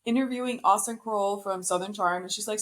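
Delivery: ordinary delivery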